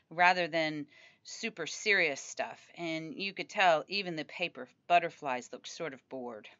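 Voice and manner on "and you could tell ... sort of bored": plain reading voice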